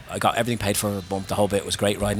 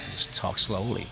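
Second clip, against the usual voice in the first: slow, softer demo of American pacing; 7 dB quieter than usual. Shown as a demonstration. Irishman explaining how to lose your accent, slowed down. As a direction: stretch it out slowly and quietly. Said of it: "American is you just slow down".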